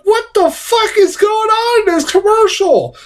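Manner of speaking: high, stunned outburst; incredulous. Retold or imitated. Reviewer recalling his shock at the ad, high incredulous. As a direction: blurt it out in disbelief. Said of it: "And I'm like".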